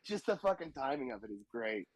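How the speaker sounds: ordinary talk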